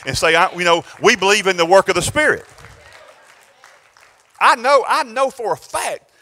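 loud, building prophetic preaching; loud prophecy over the congregation; full-force preaching. Real, not acted. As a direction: preach it out to the whole room.

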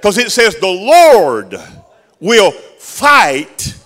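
high, loud whooping sermon cadence; full-force preaching. Real, not acted. Preacher whooping the climax at the congregation. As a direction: ride the rhythm all the way up.

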